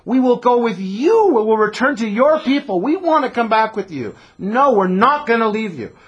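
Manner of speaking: high, loud insistent plea; pleading daughters. Acted. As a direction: refuse to leave her, pleading loudly. Daughters insist on going along.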